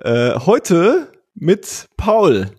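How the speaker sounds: high, drawn-out podcast intro voice; grand gala show opening; put-on intro voice. Real, not acted. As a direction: announce it slowly and grandly, pitched well up. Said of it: "das ist meine Intro-Stimme".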